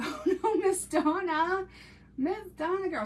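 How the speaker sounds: high, loud, drawn-out oh no to a friend; shocked exclamation. Real, not acted. Teasing a viewer called Miss Donna who got pulled over, in high, loud mock shock. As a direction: gasp out her name in loud mock horror.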